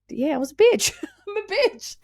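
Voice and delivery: giggling it out, pitch jumping high; laughing admission. Real, not acted. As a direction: laugh at yourself while confessing.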